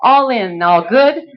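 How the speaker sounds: rapid rhythmic bid chant; auctioneer. Acted. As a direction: rattle off bids like an auctioneer.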